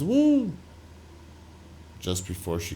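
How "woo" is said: high, loud "woo!"; imitated excited whoop. Retelling the driver just before she crashes, imitated excited whoop. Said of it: "she's, like, excited and says".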